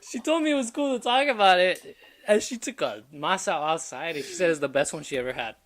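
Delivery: high, loud, cracking up while telling it; laughing. Real, not acted. Telling the co-host his girlfriend's secret, laughing. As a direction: talk through real laughter.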